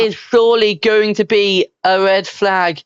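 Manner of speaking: excited race commentary; motorsport commentator. Acted. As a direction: call the crash like F1 TV. S0 commentating the crash.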